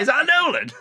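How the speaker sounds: high, loud yelp of disbelief; hammed-up astonished shout; incredulous. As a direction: blurt it out in comic outrage, nearly laughing. Spots celebrity among the booers.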